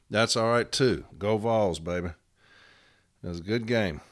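ordinary talk, nothing marked

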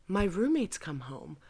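ordinary talk

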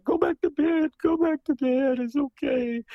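high motherly hush-you-back-to-bed voice; dramatized mother. Retold or imitated. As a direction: voice the mother shooing her child back to bed. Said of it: "pardon my, uh, characterization".

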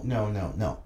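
ordinary talk

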